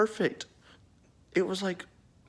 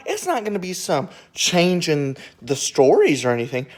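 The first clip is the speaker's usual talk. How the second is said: usual speaking voice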